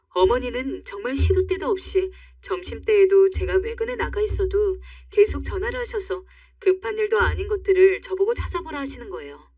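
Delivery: ordinary delivery, nothing marked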